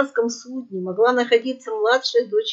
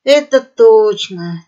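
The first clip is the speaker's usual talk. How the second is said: heavy sigh; 10 dB louder, slower than usual. A narrator's reading. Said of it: "тяжело вздохнула Айзулидзе".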